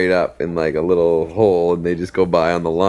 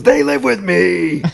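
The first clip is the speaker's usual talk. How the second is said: high slow punchline voice; 12 semitones higher, 3 dB louder, slower than usual. Retold or imitated. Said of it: "And he goes".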